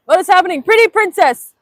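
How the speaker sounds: high, loud mock announcer shout; playful yelling. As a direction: holler it in hammed-up excitement. Keeps up the joke yelling.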